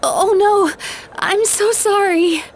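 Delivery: high, startled, then a slow apologetic "I'm so sorry"; flustered apology. Acted. Dream eater realizes travelers stumbled into her dream, flustered apology. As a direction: gasp it out in alarm, then apologize.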